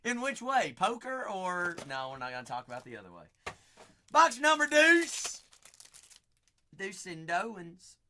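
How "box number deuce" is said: much higher and louder, like a ring announcer; excited announcement. Real, not acted. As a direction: call it out big to the viewers. Announcing the next box.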